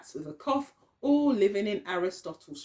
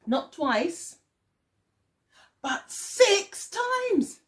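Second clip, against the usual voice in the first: dramatic reveal; 9 semitones higher, much slower than usual. A narrator's reading.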